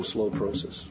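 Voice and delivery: ordinary delivery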